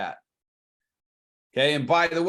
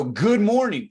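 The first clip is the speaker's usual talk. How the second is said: loud, higher, upbeat hello; enthusiastic greeting; 4 semitones higher, 7 dB louder, slower than usual. Shown as a demonstration. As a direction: launch the greeting with energy. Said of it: "like, with some enthusiasm".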